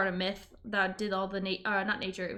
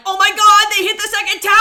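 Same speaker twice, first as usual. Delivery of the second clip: loud, high, shocked yelling; 10 semitones higher, 17 dB louder, faster than usual. Retold or imitated. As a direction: yell it like shocked fans. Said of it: "everyone was like".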